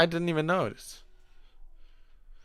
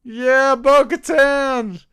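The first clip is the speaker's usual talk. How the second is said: higher, louder and slow cheers; excited cheering; 9 semitones higher, 5 dB louder, much slower than usual. Real, not acted. Cheering favorite characters during the episode. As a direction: hype them up like a fan.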